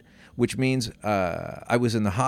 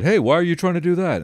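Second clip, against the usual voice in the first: bystander challenging; 7 semitones higher, 4 dB louder than usual. Retold or imitated. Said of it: "interloper at the corner said".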